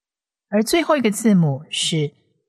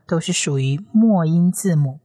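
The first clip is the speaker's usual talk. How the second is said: ordinary talk, nothing marked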